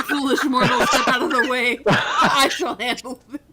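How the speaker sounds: loud laughing mock villain; booming echoing villain monologue. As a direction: boom it like a pompous villain. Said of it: "He's like".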